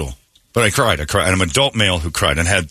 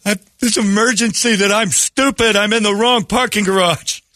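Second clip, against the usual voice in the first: much higher, whiny self-mockery; mock whiny caller; 13 semitones higher than usual. Lost man confessing to dispatcher, high whiny mock. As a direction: whine it like a foolish child.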